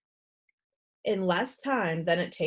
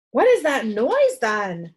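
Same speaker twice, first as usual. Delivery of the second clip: puzzled alarm; 8 dB louder than usual. Acted. Nurse alarmed by noise.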